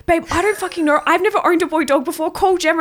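a panicked friend throwing the problem back; flustered friend. Retold or imitated. Friend on the phone answering a panicked call, flustered and loud. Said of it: "And Amand's like".

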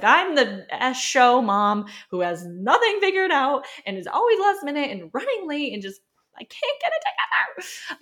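hammed-up cry of the mom who can't cope; frazzled self-mockery. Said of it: "I was like".

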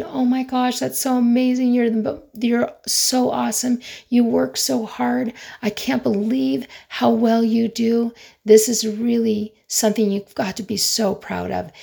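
high-pitched, mildly hammed-up cheering; retold excited gushing. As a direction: re-enact an excited outburst of praise. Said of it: "I would start screaming, jumping".

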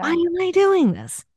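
high-pitched, exasperated question to yourself; exasperated self-questioning. Retold or imitated. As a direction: act out baffled self-reproach, pitched high. Acts out questioning herself, repeated higher.

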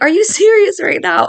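high, loud mock disbelief; incredulous teasing husband. Husband teasing his scared wife, high loud disbelief. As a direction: tease her, loud and high: are you serious. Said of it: "He was like".

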